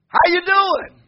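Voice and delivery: high, loud call to the crowd; pumped-up greeting. Retold or imitated. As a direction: holler a greeting along the row.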